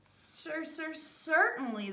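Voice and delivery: lightly voiced squirrel, high and slow; a hint of a fussy little squirrel. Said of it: "the squirrel replies".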